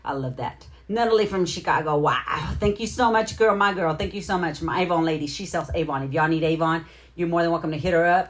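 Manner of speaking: usual speaking voice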